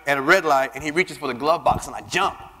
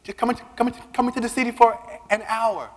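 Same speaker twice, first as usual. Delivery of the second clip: higher, wheedling plea; coaxing plea; 7 semitones higher than usual. Retold or imitated. Asking Nicole to visit, higher wheedling plea. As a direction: coax her sweetly into coming. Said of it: "I'm like".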